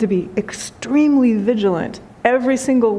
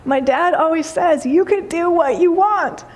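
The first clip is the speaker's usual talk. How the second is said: touched daughter; 6 semitones higher than usual. Retold or imitated. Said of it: "And she says".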